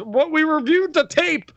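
high-pitched mock announcement voice; Shane McMahon. Host acting out next week's ruling, high put-on voice. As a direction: put on a high official's voice, easing down. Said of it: "Shane McMahon goes".

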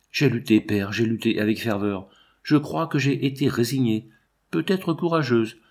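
plain reading voice

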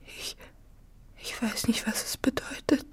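high, breathless whisper. Acted. Anna whispering her confusion to Manao. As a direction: whisper it, lost for words. Anna shaken, barely speaking.

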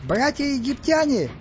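high, drawn-out squeaky call; squeaked proclamation. A narrator's reading. Stas addresses the Egyptians from above, squeaking. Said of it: "пискнул Стас сверху".

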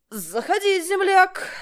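high, loud welcoming call; shouted welcome; loud invitation. A narrator's reading. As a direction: wave him in loudly. Akim calling neighbor in.

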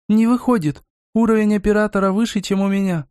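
high, lightly mechanical voice from under a helmet. A narrator's reading. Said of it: "раздался механический голос из-под шлема". (слегка механический высокий голос)

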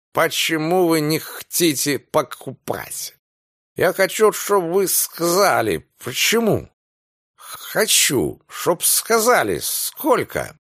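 put-on insistent, slurring drunk demand; drunken demand. A narrator's reading. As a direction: press them for an answer, tipsy. Drunk Anthony demanding answer. (настойчиво, пьяно требуя ответа)

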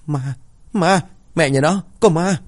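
high-pitched panic at a ghost; stammering in fear. A narrator's reading. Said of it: "miệng lắp bắp không thành tiếng".

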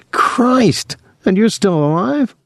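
high-pitched, incredulous exclamation; disbelieving, pitch way up; astonished. A narrator's reading. Astonished at brother's confession.

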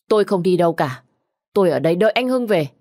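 loud, fast, stubborn; defiant refusal. Acted. Widow shouts back she will wait for husband. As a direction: plant your feet and shout back.

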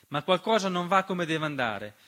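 ordinary talk, nothing marked

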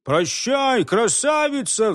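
high-pitched farewell call, no real shout; mild cry. A narrator's reading. Another lad bids the beauty farewell, mild high call. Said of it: "вскричал другой".

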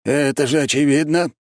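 high, touchy retort; irritated. A narrator's reading. Архимаг answers about the shifted border, irritated. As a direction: answer as if bothered by the question. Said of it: "раздражённо дёрнув плечом".

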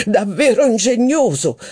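high, slow, affected exclamation; exclaiming. A narrator's reading. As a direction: marvel at the phrase with mock delight. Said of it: "esclamò Legrandin".

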